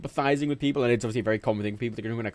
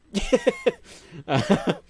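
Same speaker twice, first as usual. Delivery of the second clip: loud, high cackling laugh; burst of laughter; 9 semitones higher, 6 dB louder than usual. Real, not acted. Streamer laughing at his drunk story, burst of laughter. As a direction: crack up at your own story.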